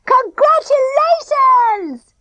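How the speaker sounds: slow, high drawn-out cheer; boy crowing slowly; gleeful shout. A narrator's reading. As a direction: stretch it out, triumphant. Denzel cheers before prank.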